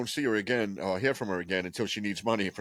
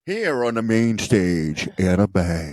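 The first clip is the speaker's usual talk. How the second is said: slow, louder announcer boom; strip club emcee; 4 semitones lower, 6 dB louder, much slower than usual. Pretend. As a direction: announce her to the main stage, slow and loud.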